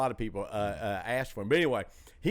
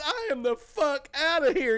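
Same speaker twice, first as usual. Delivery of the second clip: high, whiny, panicked exit line; 13 semitones higher, 4 dB louder than usual. Retold or imitated. Comedian voicing a scared partygoer, high and panicked. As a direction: squeak it out like you're bolting. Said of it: "he goes".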